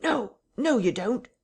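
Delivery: high, urgent cry of protest; sharp cry. A narrator's reading. Someone struggling behind the parlour door, crying out sharply. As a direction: cry out sharply, pitched well up. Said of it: "then a sharp cry of".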